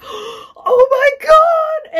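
horrified slow wail; shocked cry. Retold or imitated. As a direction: gasp then wail it out slowly. Discovering slashed bag in dream.

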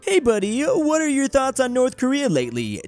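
fake-friendly greeting. Voicing a fake-friendly call.

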